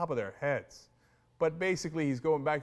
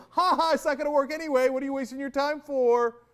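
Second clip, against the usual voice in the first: mock gloating; 8 semitones higher, 5 dB louder than usual.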